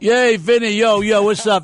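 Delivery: high, loud cheer; excited greeting. Real, not acted. Greeting caller Vinny on the radio, excited and loud.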